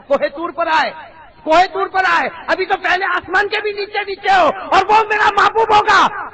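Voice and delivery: loud, high preaching voice; booming sermon. Acted. Preacher booming to the congregation at full force. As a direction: preach it out to the crowd. Building to shout.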